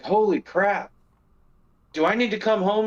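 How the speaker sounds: a touch of alarmed husband at work. Retold or imitated. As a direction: replay your own startled reaction. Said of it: "And I'm like".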